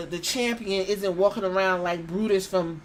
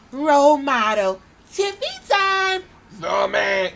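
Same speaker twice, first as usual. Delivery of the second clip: mock catchphrase; 4 semitones higher, 6 dB louder, slower than usual. Mocking wrestler catchphrases.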